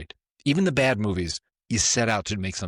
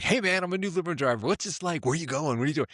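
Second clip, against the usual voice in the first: high, quick chatty newbie voice; eager limo driver; 8 semitones higher, faster than usual. Retold or imitated. Limo driver pestering Bruce, high and eager. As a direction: play the nervous new driver, pitched way up. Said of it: "He's like".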